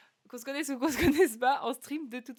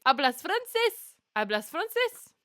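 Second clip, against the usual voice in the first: surprised question; 5 semitones higher, 5 dB louder, slower than usual. Real, not acted. Surprised viewer speaks French.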